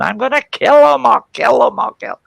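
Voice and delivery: very high, loud imitation of a screaming sister; mock screaming. Sister threatening her brother as dad arrives, screaming. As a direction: shriek the threat in hammed-up falsetto. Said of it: "he hears my sister screaming".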